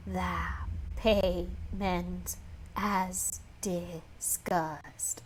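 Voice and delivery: very slow, rasping, cut-off words; raspy creature voice. Acted. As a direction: rasp each sound and stop short. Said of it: "It rasped every sound and cut out suddenly".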